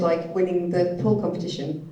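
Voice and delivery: ordinary talk, nothing marked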